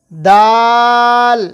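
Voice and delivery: দাল held long and even; drawn-out recitation. Shown as a demonstration. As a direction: stretch দাল for students to repeat. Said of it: "যেভাবে পড়ছি আমি, সেভাবে আপনাকে পড়তে হবে". (দাল টেনে লম্বা করে বলা)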